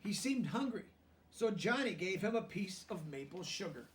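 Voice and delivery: lively storybook reading aloud; reading to children. A narrator's reading. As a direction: read it out to the class, bright and clear.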